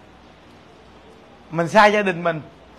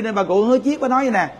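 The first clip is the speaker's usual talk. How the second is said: ordinary delivery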